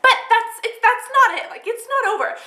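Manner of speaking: high, laughing, eager to go on; bubbling over with a laugh; excited. Real, not acted. To her viewers, excited that the story is not over.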